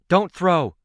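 loud, high urgent command; ordering. A narrator's reading. Daughter stopping dad's grenade throw, shouted order. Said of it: "I ordered my dad".

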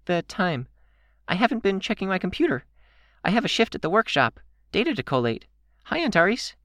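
quick, very high character voice. A narrator's reading. Kenzie explaining her shift, plainer high voice. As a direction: rattle it off in a high voice. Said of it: "More plainly, she said".

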